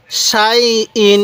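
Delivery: সাই ইন read very slowly, both syllables drawn out; slow drawn-out recitation. Shown as a demonstration. Teacher reciting সাই ইন to students, slowly drawn out. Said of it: "কীভাবে পড়ব".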